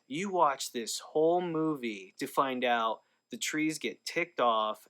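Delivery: ordinary talk, nothing marked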